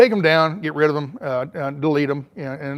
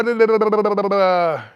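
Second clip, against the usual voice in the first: tongue-rattling run of da-da-da, raised pitch; rapid "da-da-da" filler; 4 semitones higher, 3 dB louder than usual. Real, not acted. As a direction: rattle off nonsense syllables while searching. Filler noise while scanning comments.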